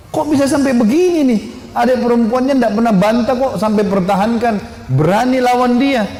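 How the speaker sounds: high, astonished inner voice; amazed disbelief. Acted. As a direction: marvel at her courage. Umar amazed at Fatimah's defiance.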